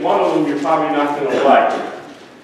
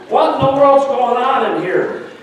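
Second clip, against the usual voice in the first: a pastor dad bursting in, higher and sharper; stern dad; 7 semitones higher than usual. Retold or imitated. Pastor father catching boys in the bathtub, exasperated. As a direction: demand answers from the noisy bathroom. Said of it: "and he says".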